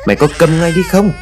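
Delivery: high-pitched angry demand; loud scolding. A narrator's reading. Grandmother silencing the crying boy, high angry scolding. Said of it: "Bà quát to".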